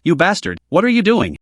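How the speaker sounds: usual speaking voice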